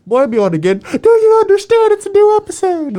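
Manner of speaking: very high, loud mock dad, laughing at the end; hammed-up impression of an excited father; imitating dad. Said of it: "my dad would always say".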